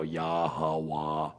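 Yahweh read slowly and stiffly, each syllable apart. Retold or imitated. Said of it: "they go".

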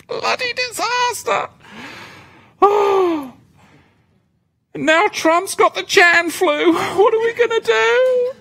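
high, whiny mock-panic voice; mock panicked worrier. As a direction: squeal like a frantic worrier, hammed up.

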